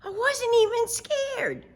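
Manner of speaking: put-on high proud little girl; Sheila's voice. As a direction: brag about the adventure. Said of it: "said Sheila".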